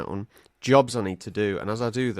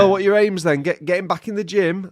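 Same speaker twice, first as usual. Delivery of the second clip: loud, higher physio voice; brisk imitation; 9 semitones higher, 6 dB louder than usual. Physio quizzing a patient, loud brisk imitation. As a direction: imitate an upbeat questioner, loud and pitched up.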